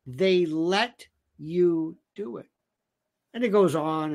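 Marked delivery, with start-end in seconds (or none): 0.1-2.5 slow, separated words, softer; measured, drawn-out delivery with pauses; slow, deliberate repeat. Acted. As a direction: spell it out word by word. Repeats the line word by word.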